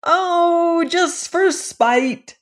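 high, slow careless dwarf voice; high, slow, careless creature bawl. Acted. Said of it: "bawled the dwarves carelessly".

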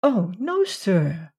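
reply simply, without any fear; simple. A narrator's reading. Woman reassuring Randolph, simple childlike voice. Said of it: "she replied simply".

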